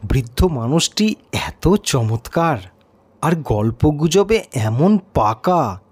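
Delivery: usual speaking voice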